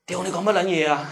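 a slip of startled Cantonese swearing; blurting Cantonese. Retold or imitated. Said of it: "吓得我的广东话都出来了". (受惊脱口而出的粤语)

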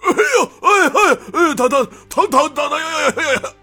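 very high, loud yelps of pain; cries of pain. Acted. Axe bandit sprawled on the ground, yelping in pain. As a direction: howl like he landed on his face. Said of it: "只听他哎呦哎呦地叫着".

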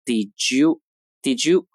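"did you" blended into one sound, slowed; teacher demo of "did you" linked. Shown as a demonstration. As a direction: run "did you" together slowly twice. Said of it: "所以did you会连读成".

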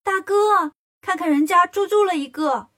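high, loud boastful call; elephant demon shouting. A narrator's reading. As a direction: holler to big brother on entering. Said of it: "白象精进门就喊".

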